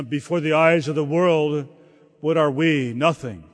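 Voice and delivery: usual speaking voice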